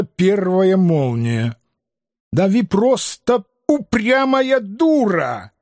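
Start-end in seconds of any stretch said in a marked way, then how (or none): 2.3-5.5 high, slow enraged shriek; furious shriek. Acted. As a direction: screech it in fury. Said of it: "Дикий визг обозлённого библиофила".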